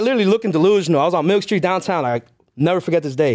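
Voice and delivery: ordinary talk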